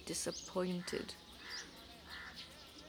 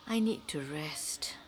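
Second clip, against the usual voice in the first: weak whisper; 2 semitones lower, 7 dB quieter, much faster than usual. Acted. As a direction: fade out needing rest. Aunt weak, needs rest.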